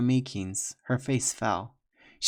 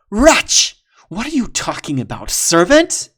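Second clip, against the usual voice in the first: loud, high outraged shout; enraged shout; furious outburst; 9 semitones higher, 12 dB louder than usual. Acted. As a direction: spit out wretch in fury. Warrior outraged.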